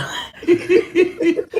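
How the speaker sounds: high, wheezy chuckle; laughing. Real, not acted. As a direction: laugh at your own punchline. Laughs after own punchline.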